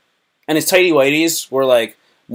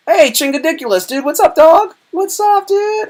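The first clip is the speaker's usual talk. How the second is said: excited shout-out; 5 dB louder than usual. Real, not acted. Greeting viewer by nickname.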